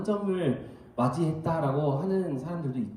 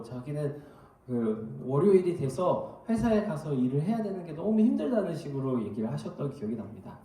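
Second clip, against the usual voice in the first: ordinary talk, nothing marked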